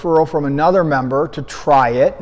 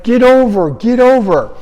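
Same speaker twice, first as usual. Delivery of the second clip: raised, higher yell of get over; retold yell; 6 semitones higher, 4 dB louder than usual. Coach yelling at a runner to move over, raised yell. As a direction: call out to the runner to get off the road.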